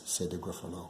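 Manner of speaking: usual speaking voice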